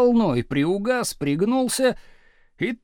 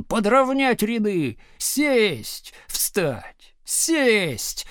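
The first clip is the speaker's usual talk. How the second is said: slow, drill-style commands; thundering orders; 2 semitones higher, much slower than usual. A narrator's reading. Teacher drilling rioting class, thundering orders. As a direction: boom each order out separately. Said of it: "тоже гремел Слушкин".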